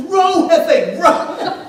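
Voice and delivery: very loud, high-pitched retold command; hammed-up urgent shout; imitated rowing yell. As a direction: holler it at a slacking rower. Imitates teammate yelling at him on raft.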